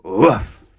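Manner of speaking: barked woof, loud. A narrator's reading. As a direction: bark it out like a big dog. Loud noise in Loudland.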